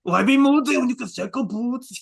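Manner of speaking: loud, high mock Austrian accent; Arnold accent. Shown as a demonstration. Retrying the German phrase, hammed-up Arnold accent. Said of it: "official Arnold accent achieved".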